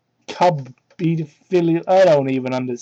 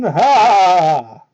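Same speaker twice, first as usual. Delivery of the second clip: mock shout; 8 dB louder than usual. Fusion dance shout.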